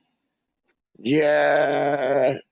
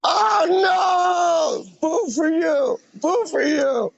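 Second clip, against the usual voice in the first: high-pitched mock dismay; teasing, exaggerated high boo; playful booing; 12 semitones higher, much slower than usual. Real, not acted. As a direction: jeer playfully in a high, drawn-out voice. Teasing reaction to passer-by's answer.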